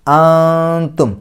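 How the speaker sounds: very slow, high ആന്തും with a long hum before t; concealed n. Shown as a demonstration. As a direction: hide and hold the n in ആന്തും. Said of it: "ഇഖ്ഫാ ചെയ്യാം". (ആന്തും: താഇന് മുമ്പ് ഇഖ്ഫാ ചെയ്യുക)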